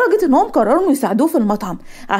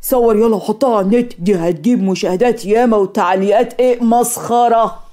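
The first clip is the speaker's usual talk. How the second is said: put-on jeering onlooker's voice; mocking bystander. Onlooker urging friends to film her for the net, gleefully mocking. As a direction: egg on the filming, slow and mocking. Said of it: "وناس تانية كانت بتصور".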